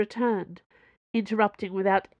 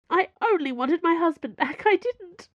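much higher, louder, close to breaking; upset pleading; 10 semitones higher, 4 dB louder than usual. A narrator's reading. Madame Sue pleads with the cardinal, rising and upset. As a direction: plead your innocence, voice climbing. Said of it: "She broke off".

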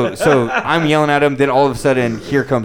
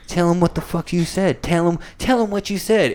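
imitated wife; 5 semitones higher, 3 dB quieter than usual. Wife goads her husband about the fan, imitated. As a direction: egg your husband on in mock wifely voice. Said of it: "his wife is sitting there going".

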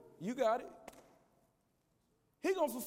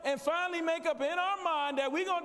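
loud, driving sermon climax; rally the church to action; preaching at full force; 3 semitones higher, 3 dB louder than usual. Acted. Preacher rallying the congregation to win the city, full force.

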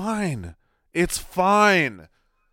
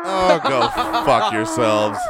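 laughing exasperation; 5 semitones lower, 4 dB louder than usual. Real, not acted. Exasperated reaction to fan campaign.

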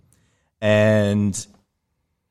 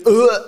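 mock disgusted ugh; 12 semitones higher, 7 dB louder than usual. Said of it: "then she goes".

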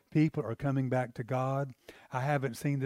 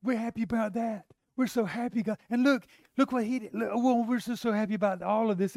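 much higher and louder, bubbling with joy; rejoicing angels; 8 semitones higher, 3 dB louder than usual. Retold or imitated. Angels rejoicing over a repentant sinner, high gushing excitement. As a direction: gush like angels who can't contain their delight. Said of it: "They're going".

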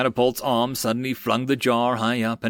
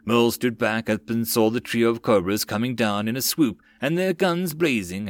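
usual speaking voice

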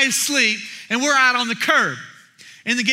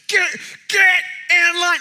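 raised, higher imitation of a yeller; mock yelling; 6 semitones higher, 4 dB louder than usual. Imitating the older man at the lady in line, yelling. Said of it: "You shouldn't yell at the lady".

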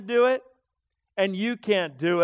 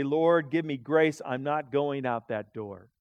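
slow, soft plea; humble prayer; 6 semitones lower, 3 dB quieter, slower than usual. Retold or imitated. On his knees asking the Lord for grace, soft and slow. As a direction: pray quietly for grace.

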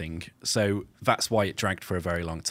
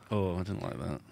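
ordinary delivery

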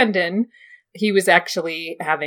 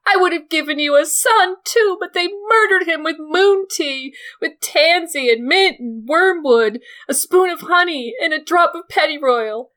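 high, loud, near-tearful jealous pleading; hysterical jealous aunt; 11 semitones higher, 3 dB louder than usual. Acted. Lysa to Littlefinger over Sansa, high, loud, tearful shouting. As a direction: Lysa begging Littlefinger to choose her.

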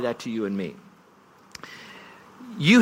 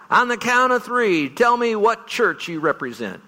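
stadium speaker call; 8 semitones higher, slower than usual. Retold or imitated. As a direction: rev up a stadium of men. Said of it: "first night speaker would begin the evening".

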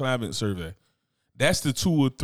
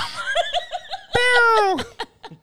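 high laugh. Real, not acted. Laughs at ending.

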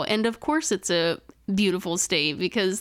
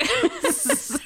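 laughing. Real, not acted. Echoing with a laugh.

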